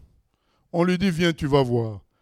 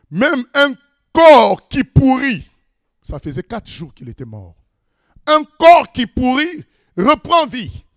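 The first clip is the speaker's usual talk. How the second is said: emphatic; 9 semitones higher, 5 dB louder than usual. Acted. Preacher exclaims over miracle.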